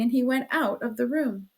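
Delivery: usual speaking voice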